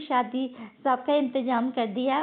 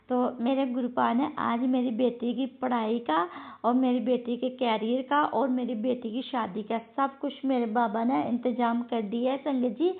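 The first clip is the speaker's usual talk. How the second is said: ordinary talk, nothing marked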